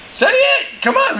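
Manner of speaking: high, eager call for an answer; urging the crowd. Real, not acted. Preacher urging the congregation to say it, high eager call.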